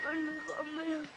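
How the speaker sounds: put-on crying child. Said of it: "تو گریه نکن دیگه پسر".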